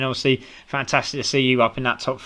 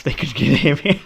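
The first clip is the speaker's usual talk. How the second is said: laughing. Real, not acted. Laughing at own breathlessness.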